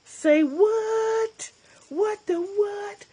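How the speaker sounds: high, slow squeaky "say what?"; stunned disbelief. Real, not acted. Surprised by the gift, high and drawn-out disbelief. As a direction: pitch way up in playful shock.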